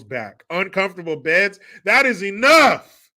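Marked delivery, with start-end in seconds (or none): 1.8-3.1 slow, loud "That is enough"; mock fury. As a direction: pound it out.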